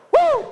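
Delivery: very high, loud "woo"; piercingly pitched victory whoop; celebratory whoop. Real, not acted. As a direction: whoop to cheer the crowd on. Cheering after the crowd chant.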